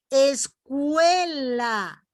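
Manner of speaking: "Escuela" alargado y lento, con la E resaltada; pronunciación lenta. Shown as a demonstration. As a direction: estira "Escuela" marcando la E. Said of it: "Fíjense". ("Escuela" muy despacio, con la E fuerte)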